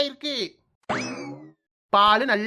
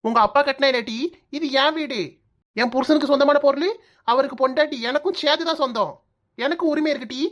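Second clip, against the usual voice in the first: normal talking voice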